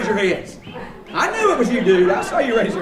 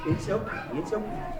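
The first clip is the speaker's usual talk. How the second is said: soothing; 8 dB quieter than usual. Real, not acted. Soothing baby ducks.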